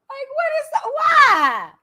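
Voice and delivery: high, loud cry of disbelief; shouted why of disbelief; exasperated outburst. Real, not acted. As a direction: throw up your hands and shout why.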